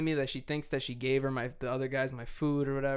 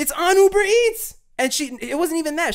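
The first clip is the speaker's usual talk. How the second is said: much higher and louder, building to an outraged shout; furious rant; 10 dB louder than usual. Real, not acted.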